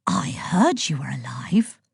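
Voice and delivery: slow secretive whisper. Acted. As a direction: breathe it like passing on gossip. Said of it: "whispered Ossa".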